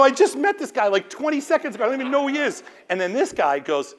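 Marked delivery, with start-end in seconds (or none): 0.0-2.6 high, loud protest of innocence; indignant protest. Retold or imitated. To flight attendants, a high, loud protest of innocence. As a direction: pitch it way up, pleading you barely know him.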